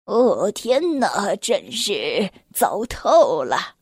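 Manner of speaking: put-on slow, low, sighing old man; sighing Santa. A narrator's reading. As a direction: drag it out in a despairing sigh. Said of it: "他叹着气， 着急地喊着".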